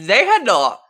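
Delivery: high, loud emphatic reply; excited exclaim. Real, not acted. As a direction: exclaim it with relish.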